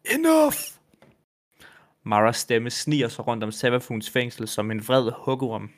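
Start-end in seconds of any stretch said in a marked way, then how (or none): 0.0-0.5 sudden, very high, loud cry; shouted. A narrator's reading. Mara stamps her foot at Savathûn, furious shout. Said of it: "sætter Mara foden ned og råber".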